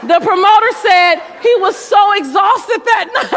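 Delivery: high, loud laughter through the words; laughing. Real, not acted. Speaker cracking up telling the audience about the interpreter, laughing loudly. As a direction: laugh as you tell it, slow and high.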